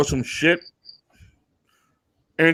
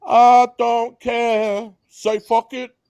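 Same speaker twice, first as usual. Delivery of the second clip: high, slow sing-song i don't care; high mock careless; 9 semitones higher, slower than usual. Cohost echoing the mask rant, high slow mock careless. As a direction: toss it off in a high mocking lilt.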